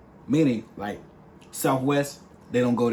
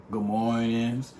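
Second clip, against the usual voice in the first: usual speaking voice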